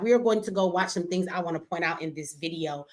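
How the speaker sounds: usual speaking voice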